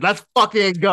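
cheering the slap in his living room; retold excited shout. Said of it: "I said".

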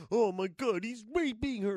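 mock him with a silly wimpy voice; mocking Mark. Ridiculing Mark's overreaction, mocking whiny imitation. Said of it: "Mark's reaction was".

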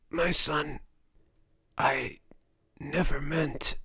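halting, strained last words; dying rasp. A narrator's reading. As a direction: let the rasp break off between failing breaths.

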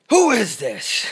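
put-on old man yelling into his phone. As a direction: bark it like a bewildered grandpa. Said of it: "he was like".

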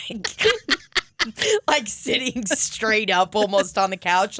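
ordinary talk

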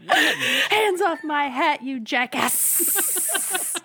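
loud, high, drawn-out mock wail; hammed-up ghost outburst. Pretend ghost guards its hat, loud hammed-up wail. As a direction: play an outraged ghost, very slowly. Hosts play-act a ghost gag.

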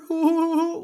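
squeaky high laugh; loud high-pitched laugh; high cackle. Real, not acted. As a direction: cackle shrilly at the joke. Laughing at own mockery.

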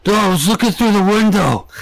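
high, matter-of-fact creep voice; a stranger admitting he watched through the shop window; creepy stranger. Retold or imitated. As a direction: admit it innocently. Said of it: "He goes".

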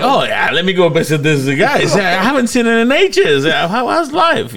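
high, mock-cheerful sarcasm; sarcastic cheer. Kidnapped teacher mocks his own situation with sarcastic cheer. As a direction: pretend it's a friendly visit. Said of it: "I was like".

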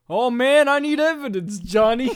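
playful put-on police chief; film boss demanding. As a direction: belt out a comic boss's complaint. Voicing the film boss on boat.

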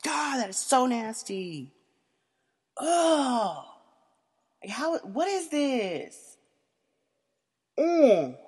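disgusted. Retold or imitated. Retelling her reaction to the pudding story, disgusted. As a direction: recoil in disgust. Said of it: "I'm like".

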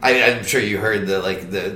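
usual speaking voice

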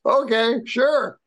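high, slow, disbelieving yeah okay sure; mock agreeable. Agreeing to the trainer's plan, mock agreeable. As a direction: humor him with a skeptical, drawn-out yes. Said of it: "And I laughed and I said".

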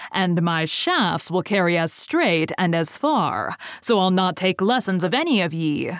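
ordinary delivery, nothing marked

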